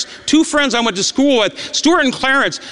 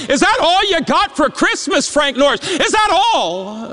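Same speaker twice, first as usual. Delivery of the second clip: taunting; 6 semitones higher than usual. Retold or imitated. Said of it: "as their taunts grew louder".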